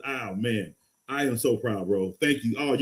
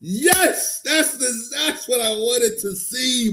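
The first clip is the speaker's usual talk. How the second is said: excited; 8 semitones higher, slower than usual. Real, not acted. His player reached the championship.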